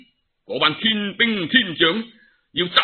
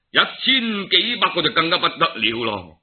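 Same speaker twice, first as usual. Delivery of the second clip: usual speaking voice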